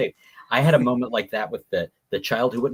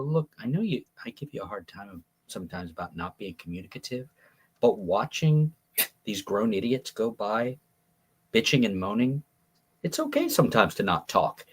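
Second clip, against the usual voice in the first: leaning in, hushed and gentle; quiet confiding words to a child in pain; soft confiding; 5 semitones lower, 5 dB quieter than usual. Retold or imitated. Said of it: "I lean over to him and I go".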